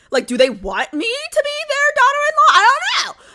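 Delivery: high, loud, frantic mock scream; screaming at the game in fake outrage. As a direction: shriek it out in playful panic. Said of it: "lightheaded right there screaming".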